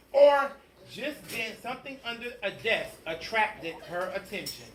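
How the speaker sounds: ordinary delivery, nothing marked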